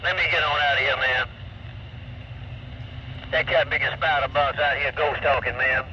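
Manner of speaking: trucker talk through CB static; over cb radio. Real, not acted. CB radio clip playing.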